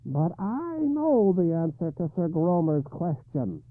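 high, slow harsh croak of a hag. A narrator's reading. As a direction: croak it out like a grotesque old woman. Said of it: "The harsh voice went on".

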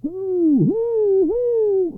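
high, loud hooting wail; long loud high hoots; crowd's cry. A narrator's reading. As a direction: cry out like a whole town. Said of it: "cried the people of Chelm with one voice".

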